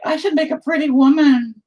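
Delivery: high, airy put-on voice for his own plan; high mock self-quote. Painter quoting himself in a high voice, mocking. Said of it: "'Cause I was like".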